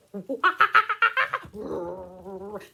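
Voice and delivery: loud, high gorilla hoots; playful gorilla noises. Pretend. Teacher showing children a crazy gorilla dance, loud playful whooping. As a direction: whoop wildly like a silly gorilla.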